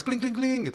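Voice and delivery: high-pitched kling sound; imitated SMS chime. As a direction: chime like an incoming text. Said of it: "Dapat S M S". (tiruan bunyi SMS bernada tinggi)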